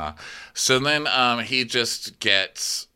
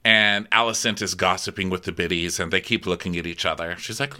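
ordinary talk, nothing marked

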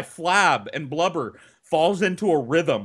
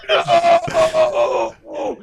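much higher, louder squeaky yelp; squealed cry; 6 dB louder than usual. Retold or imitated. Retelling the gasped plea to his friend, squeaky yelp. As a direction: squeal it like the air is crushed out of you. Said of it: "all that came out was".

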